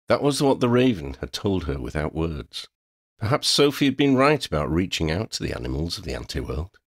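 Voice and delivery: usual speaking voice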